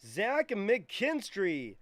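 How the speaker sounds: loud, slow excited callout. Real, not acted. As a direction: shout the name out with excitement. Big card pulled in break.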